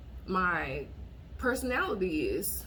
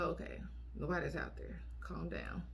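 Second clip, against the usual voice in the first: calming herself; 3 semitones lower, 9 dB quieter than usual. Retold or imitated.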